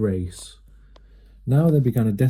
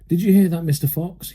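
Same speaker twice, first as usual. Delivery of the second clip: high louder taunt; yelled; 6 semitones higher, 4 dB louder than usual. A narrator's reading. Bean shouting down the fox hole, high taunting yell. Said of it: "yelled Bean".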